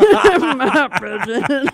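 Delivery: loud, slow, rising character line. Acted. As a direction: belt it out in a big character voice. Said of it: "this huge range".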